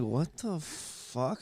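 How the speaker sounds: slow, hushed disbelief; groggy bafflement. Retold or imitated. Sleeper hearing singing in the dark, slow baffled murmur. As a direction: draw it out softly, half-asleep.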